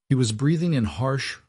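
plain reading voice

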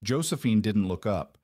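ordinary delivery, nothing marked

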